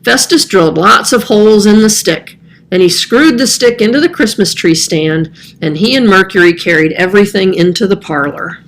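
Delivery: usual speaking voice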